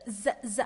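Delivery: teacher isolating a voiced Z; short repeated "zz" for Z; voiced z sound. Shown as a demonstration. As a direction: buzz Z, Z as separate sounds. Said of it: "on prononce z".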